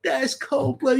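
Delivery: high, loud, half-laughing exclamation; cracking up at the burn; laughing disbelief. Retold or imitated. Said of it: "I was like".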